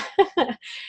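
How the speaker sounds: quick high giggle; high laugh. Real, not acted. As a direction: giggle at yourself. Laughing at her own image.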